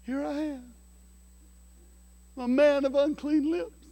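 lightly voiced prophet, high and humble; like Isaiah. Preacher lightly voicing Isaiah before God, high and humble. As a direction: offer yourself up like the prophet Isaiah, a touch hammed. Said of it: "like Isaiah".

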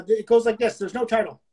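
ordinary delivery

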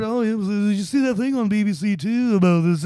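imitating George. George telling the band a TV story, lightly imitated. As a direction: gossip like George. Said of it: "George telling this story".

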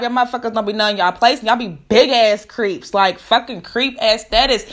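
ordinary talk, nothing marked

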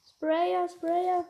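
loud, high frantic yell; shouting. Real, not acted. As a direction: yell it out in the heat of the fight. Yelling at an enemy spraying.

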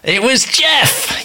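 high, drawn-out exclamation; excited reveal. Real, not acted. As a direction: announce it as a gleeful big reveal. Reveals who the reader was.

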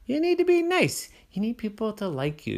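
high bossy lecture; a bossy sister's voice; scolding sister. A narrator's reading. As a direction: lecture him like a big sister. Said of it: "his sister said".

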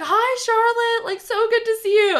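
high, mock-sugary greeting, slowed; fake sweet aunt. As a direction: ham up a fake-friendly hello. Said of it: "she's like".

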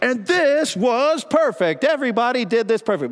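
high, sing-song mocking of a rosy view; mock naive claim. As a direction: put on a naive voice to mock the idea. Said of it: "So I'm not saying".

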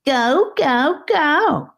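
mock the child in a squeaky voice; mocking high-pitched snake. A narrator's reading. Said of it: "the snake mocked in a high-pitched voice".

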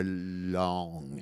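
LONG dragged out slowly; drawn-out emphasis. Real, not acted. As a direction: stretch out the word long. Stretches the word to show length.

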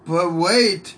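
loud pitchman shout; infomercial voice. Pretend. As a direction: sell it like a TV ad.

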